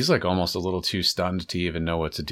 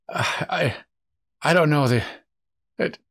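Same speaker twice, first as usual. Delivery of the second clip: flustered prisoner; 6 semitones higher than usual. Acted. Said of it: "They ambushed us".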